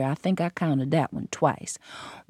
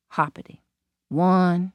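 usual speaking voice

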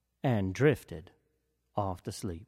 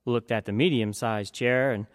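ordinary delivery

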